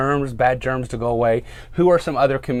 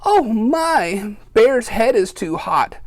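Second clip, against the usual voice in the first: a higher, fussing little mouse; mouse muttering; 10 semitones higher, 4 dB louder, much slower than usual. Acted. Mouse frets over Bear's fever, higher fussing voice. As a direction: worry over a hot head. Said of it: "Mouse mutters".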